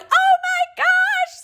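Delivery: very high, stretched-out squeal; excited screaming. Retold or imitated. Retelling her joy at the drive-through, excited screaming. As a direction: draw it out in a high, elated squeal. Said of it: "screaming in my car".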